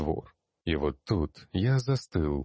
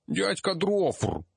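high slow whine; whining child; slower than usual. Acted. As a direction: whine like a hurt little kid. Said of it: "заныл поднявшийся с пола гномик". (заныл, детским голосом)

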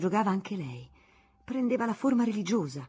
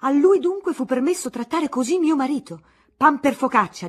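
indignant rant; 8 semitones higher, 11 dB louder than usual. Acted. Aunt ranting against Monsignore.

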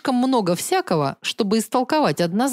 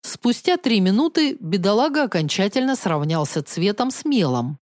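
ordinary delivery

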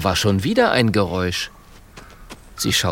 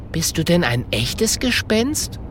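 higher little questioning voice; curious porcupine; 7 semitones higher than usual. Acted. Mathilda questions the weeping ghost, curious. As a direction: ask it lightly, up high.

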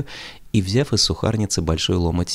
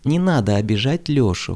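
flustered plea; 7 semitones higher than usual. A narrator's reading. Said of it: "краснея до лба и волнуясь".

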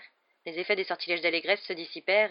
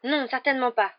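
indignant, raised denial; huffy, louder refusal; protesting; 7 semitones higher, 5 dB louder than usual. A narrator's reading. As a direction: object firmly in a higher voice. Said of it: "protesta Hermione".

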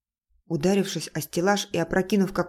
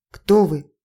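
repeated question, raised hard; louder demand; 8 dB louder than usual. Acted. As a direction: push the question out loud.

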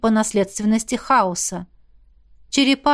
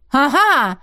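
gleeful louder gotcha; triumphant; 3 semitones higher, 4 dB louder than usual. A narrator's reading. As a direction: crow with triumph. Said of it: "возликовала она".

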